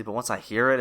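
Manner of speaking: usual speaking voice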